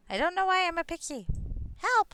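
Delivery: high, loud panicky pixie. Acted. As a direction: squeak for help like a fairy. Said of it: "how to do a pixie voice".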